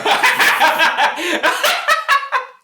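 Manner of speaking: loud high burst of laughter; big laugh. Real, not acted. Laughing at his co-host's mix-up, loud and high.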